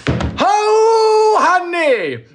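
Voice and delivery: high, loud drawn-out call. Real, not acted. Greeting a long-missed viewer, high excited call. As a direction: sing out a big greeting.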